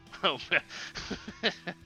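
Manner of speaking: real chuckling fit; amused laughter. Real, not acted. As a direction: crack up after reading the question. Laughs after reading the ranking question.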